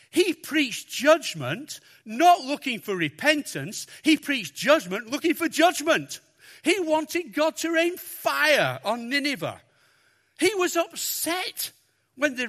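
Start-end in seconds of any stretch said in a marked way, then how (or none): 0.1-9.6 loud, emphatic preaching, hammering each phrase; preaching at full force. Real, not acted. Preacher to church, condemning Jonah's judgment, preaching loud. 10.4-11.7 mock upset. Real, not acted. Preacher mocking Jonah's sulk.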